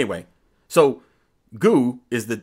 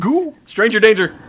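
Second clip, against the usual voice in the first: mock panic; 10 semitones higher, 7 dB louder than usual.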